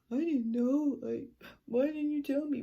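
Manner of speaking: high, loud mock wail; imitated crying mom. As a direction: act out an upset mother's plea. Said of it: "starts fucking crying".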